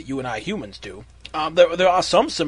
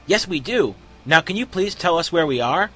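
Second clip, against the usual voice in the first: higher, sugary polite request; sweet, raised-pitch character voice; 5 semitones higher than usual. Acted. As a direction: ask ever so sweetly. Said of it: "in a very sweet voice".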